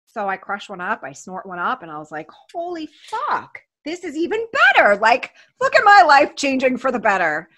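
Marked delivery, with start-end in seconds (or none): 2.5-7.5 thrilled outburst, retold; retold thrilled amazement. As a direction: reenact being blown away, loudly. Retold thrill at stronger pills.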